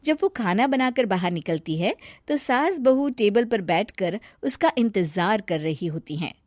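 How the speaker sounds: plain reading voice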